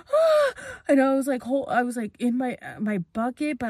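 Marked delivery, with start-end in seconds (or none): 0.1-0.5 very high, loud acted "ah"; pained cry. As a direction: re-enact crying out from the burn.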